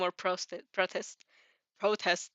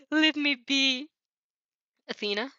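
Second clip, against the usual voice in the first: a lightly voiced teacher, higher and louder; teacher calling on student; 5 semitones higher, 5 dB louder than usual. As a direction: call her name like a teacher starting class.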